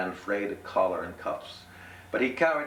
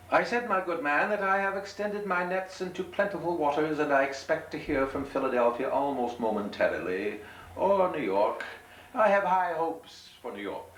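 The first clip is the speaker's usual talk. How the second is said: high, grand gentleman voice; pompous gentleman; 10 semitones higher than usual. Acted. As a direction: puff up in a high, proper voice. Gentleman boasts to innkeeper.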